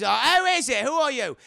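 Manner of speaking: loud, high, suspicious through the door; suspicious old landlady. Retold or imitated. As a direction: call out sharply from behind the door. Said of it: "And Miss Collins".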